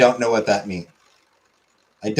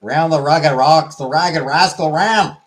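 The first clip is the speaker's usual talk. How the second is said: higher, louder Boston accent; 4 semitones higher, 4 dB louder than usual. Shown as a demonstration. Streamer showing how they'd be pronounced, louder Boston accent. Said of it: "they'd be pronounced".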